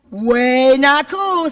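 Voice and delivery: loud WAY held very long; drawn-out catchphrase. Shown as a demonstration. Speaker answers what trash-throwing is, loud and drawn out. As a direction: stretch it out slowly for the crowd.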